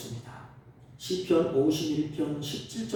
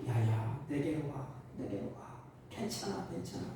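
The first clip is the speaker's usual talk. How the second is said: softer and softer, tender hush; comforting parent; 3 semitones lower, 8 dB quieter than usual. Retold or imitated. Parent calling a weeping child into their arms, soft and tender. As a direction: soothe a crying child close to you. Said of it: "부모가".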